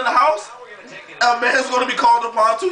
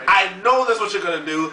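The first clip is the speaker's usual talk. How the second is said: normal talking voice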